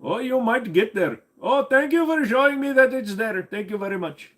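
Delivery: high, put-on Indian accent; thanking chat in a mock Indian accent. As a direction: sneak the Indian accent back in. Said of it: "I can't do an Indian accent".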